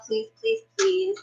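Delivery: high, soft pleading please; begging. Real, not acted. A streamer begging viewers to like the video, high and soft. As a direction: coax viewers sweetly. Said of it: "Please hit that thumbs up button".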